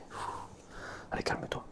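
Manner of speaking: ordinary delivery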